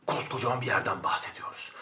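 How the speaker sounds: breathy stage whisper. Acted. Teacher whispers to students about India's size, whispering. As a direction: whisper it like a secret.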